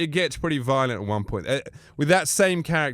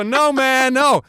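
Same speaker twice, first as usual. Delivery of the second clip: mock frat refusal; 10 semitones higher, 6 dB louder, slower than usual. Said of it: "They go".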